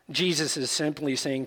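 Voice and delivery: ordinary talk, nothing marked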